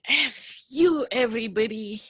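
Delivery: high, loud curse flung at everyone; venting rage at the whole world; furious outburst. Pretend. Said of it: "I just want to keep saying".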